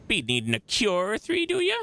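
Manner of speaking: put-on high, wheedling old-woman lilt; folksy old midwife, sharply rising question; old crone. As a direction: offer cures like a village crone, rising high. Old midwife offers cures.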